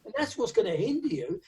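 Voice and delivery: usual speaking voice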